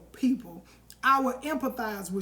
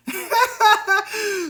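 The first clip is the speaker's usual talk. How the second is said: a hearty laugh at the comment; real laugh; 9 semitones higher, 8 dB louder than usual. Real, not acted. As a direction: burst of high, loud laughter. Wife's comment amuses her.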